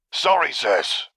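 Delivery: sorry that means the opposite; drawn-out mock apology. A narrator's reading. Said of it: "sounding even less apologetic".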